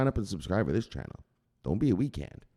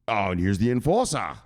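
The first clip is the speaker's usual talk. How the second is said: normal talking voice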